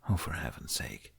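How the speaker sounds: soft weary sigh under the breath; exasperated sigh. Acted. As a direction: mutter it with a tired sigh. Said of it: "He pursed his lips and sighed".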